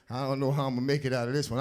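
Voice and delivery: usual speaking voice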